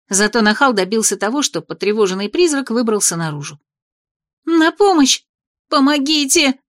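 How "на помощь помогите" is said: pitiful cry for help. A narrator's reading. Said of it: "жалобно вскрикнула я".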